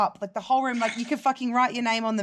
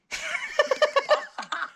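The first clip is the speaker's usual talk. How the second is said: sudden high laugh that falls away; burst of laughter. Real, not acted. Laughs at his date request.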